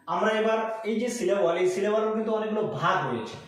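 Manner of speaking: ordinary talk, nothing marked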